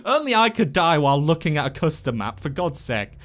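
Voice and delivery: ordinary delivery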